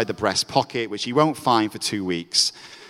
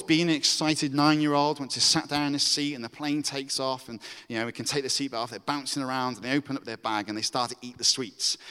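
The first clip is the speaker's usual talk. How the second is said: ordinary talk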